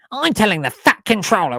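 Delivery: high, loud, petty character voice; petty tattletale. Shown as a demonstration. James threatening to report someone, petty and loud. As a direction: threaten to tell, like a petty whiner. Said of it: "more of a petty bitch".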